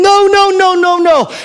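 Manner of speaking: high, loud, strained no-no-no; frantic mock refusal. Preacher mocking willpower alone, high and loud refusals. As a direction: panic the no's out, hammed up. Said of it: "not just by saying".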